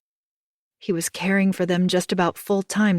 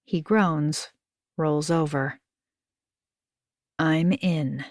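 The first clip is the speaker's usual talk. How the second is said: plain reading voice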